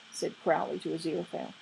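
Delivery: ordinary delivery, nothing marked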